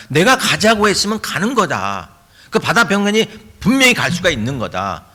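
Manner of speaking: high, forceful scolding in a preacher's voice; acted indignant challenge, pitch pushed high; impassioned rebuke. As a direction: rebuke doubters with absolute authority. Voices Jesus rebuking doubting disciples.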